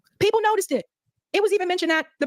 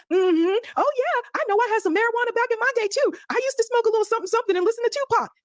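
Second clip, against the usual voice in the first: mocking pandering politician; 7 semitones higher than usual. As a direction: parody a politician pandering to sound cool.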